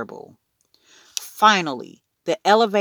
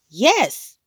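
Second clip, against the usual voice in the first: put-on high, short toddler shout; shouting back; 8 semitones higher, 4 dB louder than usual. A narrator's reading. Toddler Fudge contradicting his mother, shouting back. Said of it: "Fudge shouted back".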